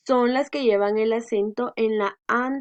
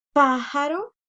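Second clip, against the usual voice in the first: pájaro with strong "PÁ", rising at the end; teacher's model pájaro, stressed "pá", lifted ending; first-syllable stress; 4 semitones higher, 3 dB louder than usual. Shown as a demonstration. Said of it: "porque no decimos pájaro".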